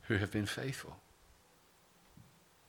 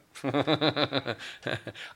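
short laugh; 4 semitones higher than usual. Real, not acted. Joke about being thinner.

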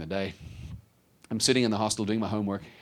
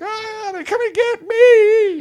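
drawn-out, high terrified scream; acted shriek, stretched out and very loud; 13 dB louder, slower than usual. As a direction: scream like a horror movie victim. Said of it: "he's, like, screaming".

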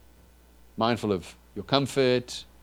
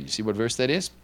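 normal talking voice

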